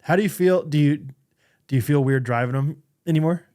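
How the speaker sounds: normal talking voice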